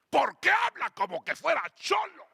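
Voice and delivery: high-pitched, scandalized scolding; indignant old woman. Retold or imitated. Elderly churchgoer scolding the preacher for his slang, indignant. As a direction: imitate an offended woman telling someone off. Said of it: "Se enojó y dijo".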